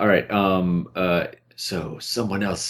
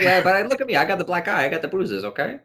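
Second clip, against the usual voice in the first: much higher, pleading fence voice; Jay Leno; 11 semitones higher than usual. Retold or imitated. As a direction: whine an apology as a shady fence. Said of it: "I don't know, Jay, Jay Leno".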